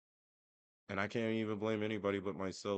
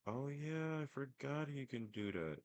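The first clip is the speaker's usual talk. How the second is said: higher, softer, drawn-out "oh yeah"; dawning realization; 7 semitones higher, 5 dB quieter than usual. Retold or imitated. Son remembers his dad's suggestion is possible, dawning realization. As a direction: act out a mock lightbulb moment. Acting out own sudden realization.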